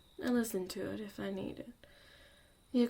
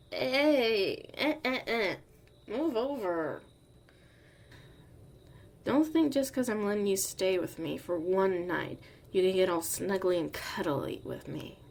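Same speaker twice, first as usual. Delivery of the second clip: grumpy loud scolding; 7 dB louder than usual. Acted. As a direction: bark at them to move over. Shoving the listener over, grumbling.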